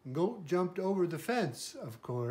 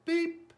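short high beep, loud; truck beep; 13 semitones higher, 5 dB louder than usual. A narrator's reading. Little blue truck answering the goat, high beep. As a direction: toot it high like a small horn. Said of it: "Blue said".

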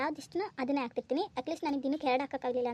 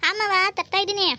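higher, louder called reply; calling back; 7 semitones higher, 8 dB louder than usual. Acted. Daughter-in-law calling back from the kitchen, loud reply. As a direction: answer loudly from the kitchen.

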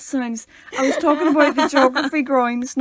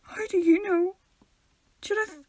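normal talking voice

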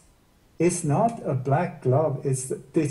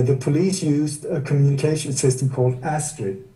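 ordinary talk, nothing marked

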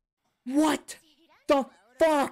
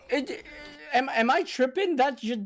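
ordinary talk, nothing marked

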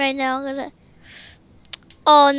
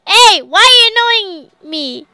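higher, louder whiny complaint; playfully voiced irritation; mock annoyed creature; 4 semitones higher, 7 dB louder than usual. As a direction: put words in an irritated creature's mouth. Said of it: "he's getting annoyed".

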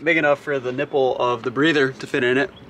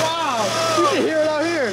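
high, delighted wow; stunned by the engine sound; amazed; 12 semitones higher, 3 dB louder, slower than usual. Real, not acted. Hearing engine start outside.

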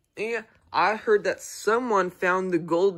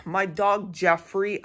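ordinary delivery

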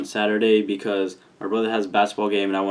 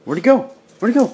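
pet talk; 13 semitones higher, 6 dB louder, faster than usual. Real, not acted. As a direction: coo at the dog, much higher. Teasing dog with laser.

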